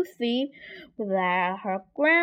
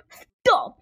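sharp shouted command, higher and louder; loud cry; 6 semitones higher, 7 dB louder than usual. A narrator's reading. Woodcutter halts the wolf's chase, loud cry. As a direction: call out loudly to halt someone. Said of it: "cried a loud voice".

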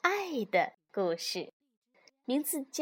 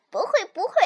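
high, louder cub voice; cheerful little fox; 8 semitones higher, 6 dB louder, much faster than usual. A narrator's reading. Little fox denies being scared, cheerful high voice. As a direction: brush it off brightly in a fox cub's voice. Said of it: "小狐狸笑着说".

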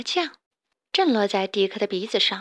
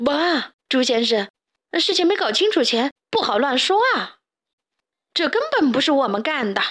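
higher, flustered protest; a bit nervous; 4 semitones higher, 4 dB louder than usual. A narrator's reading. Loudmouth answering the pig's accusation, a bit nervous. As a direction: deny it with mild alarm. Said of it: "大嗓门被流浪猪说得有些紧张".

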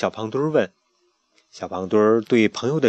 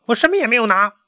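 high, loud nervous denial; frightened denial; 11 semitones higher, 4 dB louder, faster than usual. Acted. Chick lying to the pharaoh, frightened denial. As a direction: protest your innocence in fright.

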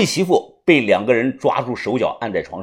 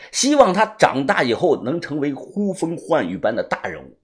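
plain reading voice

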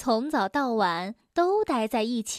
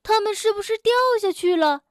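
anxious question; 8 semitones higher, 4 dB louder, faster than usual. A narrator's reading. Frog worried storks fell.

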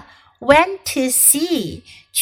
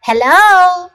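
Squirrel voice; 7 semitones higher, 7 dB louder than usual. Acted. Squirrel voiced in read story.

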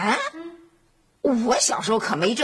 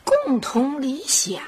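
slow, drawn-out musing echo; pondering; 4 semitones higher, much slower than usual. Acted. Mother trying to recall a childhood ideal, pondering slowly. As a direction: stretch the words while searching your memory. Mother searching her memory.

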